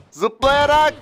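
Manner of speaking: high, loud exclaim; excited. Real, not acted. Exclaiming to viewers as the enemy jumps over. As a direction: burst it out.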